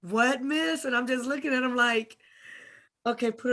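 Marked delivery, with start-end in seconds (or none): none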